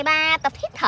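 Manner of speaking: normal talking voice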